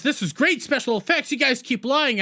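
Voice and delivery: high, scoffing sneer at the others; mocking disbelief. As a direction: scoff at them like it's all fake. [name] mocks them after the attack.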